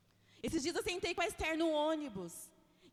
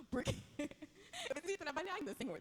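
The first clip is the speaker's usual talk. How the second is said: laughing plea; 4 dB quieter, faster than usual. Retold or imitated. Joking prayer to hurry.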